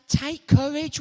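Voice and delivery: loud, bold command; rally them with it; bold call. Retold or imitated. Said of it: "Jesus say".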